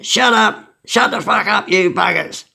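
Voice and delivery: high-pitched yell without full volume; mock yell. Prisoner yelling at noisy cells at night, mock yell. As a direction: call out like an annoyed prisoner, held back. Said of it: "someone would yell for them".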